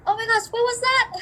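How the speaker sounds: high, quick, baffled outburst; amused disbelief, voice jumping high; mock bewilderment. Reenacting her bafflement at howdy.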